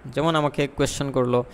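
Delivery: usual speaking voice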